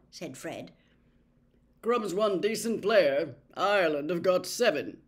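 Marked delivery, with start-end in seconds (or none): 1.8-5.0 louder, short and dismissive; curt. A narrator's reading. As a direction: cut the argument off briskly. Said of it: "said Charlie shortly".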